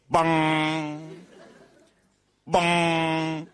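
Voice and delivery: loud, ringing "bong" noise; imitated bong. Joke teller imitating a noise heard from bed, booming bong. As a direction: boom out a held, resonant bong. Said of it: "está en la cama y oye".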